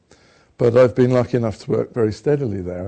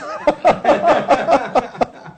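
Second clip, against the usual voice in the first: laughter rises high and bursts out; 13 semitones higher, 4 dB louder than usual. Real, not acted. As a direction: let the laugh break out high. Laughing at son's line.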